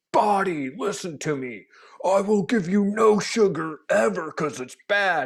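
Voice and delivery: mock strict dieter; stern lecture to body. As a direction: lay down the law to your own body. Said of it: "not that any of you are like".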